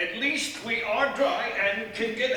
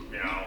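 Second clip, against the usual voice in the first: mock meow; 6 dB quieter than usual. Husband jokes it was the cat.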